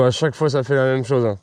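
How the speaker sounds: ordinary talk, nothing marked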